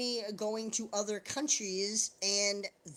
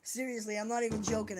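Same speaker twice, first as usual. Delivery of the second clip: usual speaking voice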